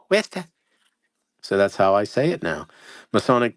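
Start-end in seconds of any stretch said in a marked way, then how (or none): 0.1-0.5 Wista said high, like the recording; imitating the Wikipedia clip. Host copies the Wikipedia audio clip saying Wista. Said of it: "this guy going".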